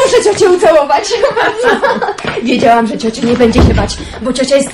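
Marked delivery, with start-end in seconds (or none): 1.1-2.4 relieved laugh. Acted. Joyful laugh continues.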